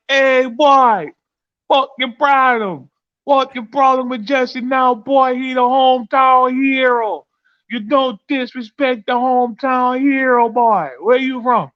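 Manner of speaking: loud, high Jamaican patois, slow and heavy; Jamaican accent. Retold or imitated. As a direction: play the angry Jamaican guy in a thick Jamaican accent. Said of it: "My bad for Jamaican accent".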